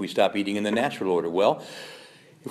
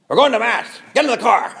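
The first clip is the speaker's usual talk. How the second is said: higher, louder, bossy parent; hammed-up parent ordering the family to Mass; mock parental order; 12 semitones higher, 10 dB louder than usual. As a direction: bark it like a parent herding kids. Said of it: "Do you talk like that?".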